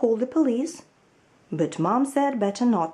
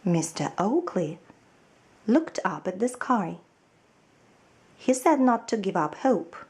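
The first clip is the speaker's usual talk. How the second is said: plain reading voice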